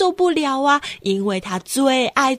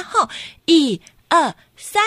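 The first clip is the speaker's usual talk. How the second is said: plain reading voice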